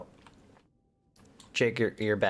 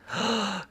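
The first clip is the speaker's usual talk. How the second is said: sharp gasp; 11 semitones higher than usual. Real, not acted. Spots a death guard.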